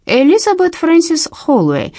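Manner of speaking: slow, tired drawl; weary drawl. A narrator's reading. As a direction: drag the name out wearily. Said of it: "устало тянет он".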